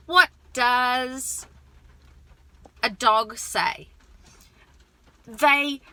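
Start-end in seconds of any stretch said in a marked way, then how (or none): 0.1-3.9 "What does" held, then a pause before "a dog say?"; question with a break. Shown as a demonstration. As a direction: stretch "What does", wait, then finish. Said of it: "as you set up this".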